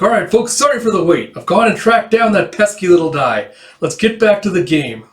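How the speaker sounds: high, loud showman announcement; performer voice. Acted. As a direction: play to the crowd. Akane addresses crowd, voiced.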